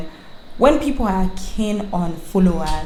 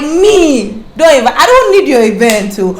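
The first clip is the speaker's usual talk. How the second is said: louder, higher indignant voice; loud defiant retort; 6 semitones higher, 7 dB louder than usual. Retold or imitated. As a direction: snap back proudly.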